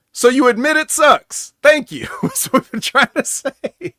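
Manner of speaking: high, loud sarcastic gotcha; mocking fan retort. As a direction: throw it back like a smug fan. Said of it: "I'm sure a lot of fans is like".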